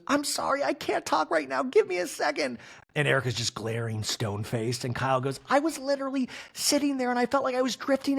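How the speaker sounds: hammed-up crying falsetto; mock weepy Kyle. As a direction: squeak out a teary plea.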